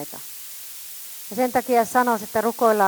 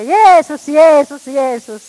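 loud, rising cries of the name; hammed-up joyful shout of Jeesus; exaggerated shouting; 4 semitones higher, 11 dB louder, slower than usual. Shown as a demonstration. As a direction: shout it out over the crowd. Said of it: "Nyt vähän liioittelen tässä".

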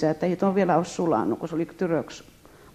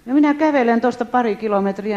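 ordinary talk, nothing marked

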